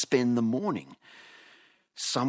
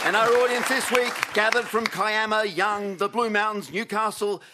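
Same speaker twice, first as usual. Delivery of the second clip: high, loud showbiz announcing; announcer roll call; 6 dB louder than usual. Acted. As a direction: call out each name like a game show host. Host announces panel and audience.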